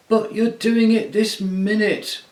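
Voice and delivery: high, loud, slow bellow. Acted. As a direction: roar it at the bear. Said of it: "bellowed Trumpkin".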